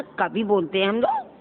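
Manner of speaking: ordinary talk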